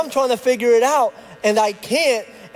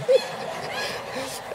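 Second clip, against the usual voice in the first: airy, unvoiced laugh squeaking up much higher; breathless high laugh; 8 dB quieter than usual. Real, not acted. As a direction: let a silent wheezing laugh escape. Laugh after own joke.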